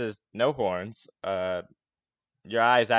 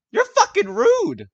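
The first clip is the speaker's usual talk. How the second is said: high, loud, indignant yell; shrieking at the newcomer who spilled his beer; angry human's outburst; 11 dB louder than usual. Acted. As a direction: snap at the clumsy stranger. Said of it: "he just stares at you like".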